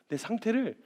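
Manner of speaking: ordinary talk, nothing marked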